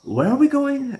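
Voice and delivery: high, loud anxious question; mocking Stevie. Stevie asking the hitchhiker where they're headed, high and loud. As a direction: pitch it way up, worried. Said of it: "he's like".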